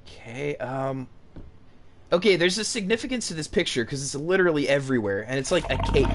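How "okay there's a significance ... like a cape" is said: ordinary talk, nothing marked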